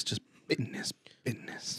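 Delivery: whisper. Pretend. Whispered echo.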